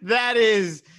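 laughing through the words, slow; words squeezed out between laughs. Real, not acted. Laughing at cat voice.

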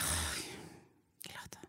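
very soft mock groan of reluctance; reluctant sigh. Reacting to her co-host's episode request, hushed mock reluctance. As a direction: barely voice the weary complaint. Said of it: "Y yo".

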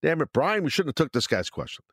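normal talking voice

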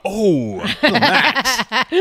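high, slow, gaping mouth laugh; mouth open voice. Retold or imitated. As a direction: stretch it out with a wide open mouth. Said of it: "Is his mouth open like".

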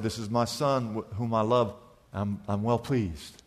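quiet, intimate retelling of God's words; soft, hushed quote. Acted. The same quote retold softly.

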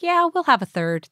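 a lightly acted carefree yes; relaxed cheerful acceptance. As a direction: toss it off happily, no pressure. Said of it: "I was actually like".

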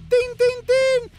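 high, loud bell ding; ding the bell with your voice; ring bell imitation.

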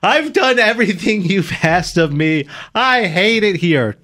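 high, slow whiny wail; childish breakdown. Retold or imitated. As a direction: replay a teen's tearful meltdown. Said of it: "I remember having a breakdown".

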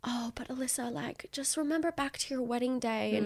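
soft, coaxing imitation of a producer; lightly voiced manipulative producer, sugary and soft; mock sweet producer. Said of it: "the funny little manipulation tactics where they're like".

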